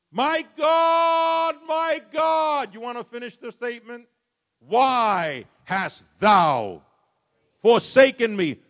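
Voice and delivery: loud, high, drawn-out cry of anguish. Acted. Preacher voicing Jesus crying out on the cross, anguished and loud.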